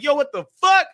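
high, loud shocked yell; shocked outburst. Retold or imitated. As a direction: blurt it out in disbelief. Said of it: "I'm like".

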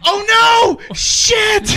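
high, loud cry of alarm; yelling as if disaster just struck; panicked shout. Retold or imitated. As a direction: shout in horrified panic, reenacted. Reenacting panic after dropping baby.

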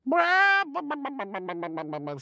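high squawking hen sound; clucking hen cry. Acted. Sister Chicken's scoffing cry.

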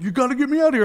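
high, frantic voice of a panicked friend; paranoid friend. Retold or imitated. Friend on the phone from the institution, high and frantic. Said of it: "He's like".

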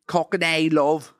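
broad, put-on Cockney; Cockney accent. Shown as a demonstration. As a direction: stretch it into caricature Cockney. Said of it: "the accent is Cockney".

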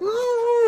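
sudden high terrified scream; woman's scream. Acted. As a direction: shriek in panic. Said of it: "starts, like, screaming".

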